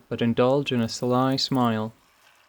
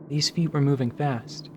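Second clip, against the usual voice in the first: plain reading voice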